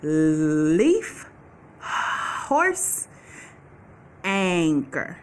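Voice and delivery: slow, drawn-out naming of each picture; careful sound-out. Shown as a demonstration. Teacher sounding out leaf, horse and anchor for the kids. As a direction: stretch each word to show its first sound. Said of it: "Which one of those pictures starts with".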